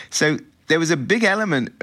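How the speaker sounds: ordinary delivery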